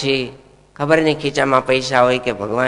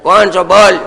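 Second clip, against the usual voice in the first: higher and louder, an outraged ruler; angry king; 5 semitones higher, 7 dB louder than usual. Acted. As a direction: thunder like a king defending his animals. King demands the stranger's name.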